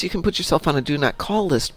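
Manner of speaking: normal talking voice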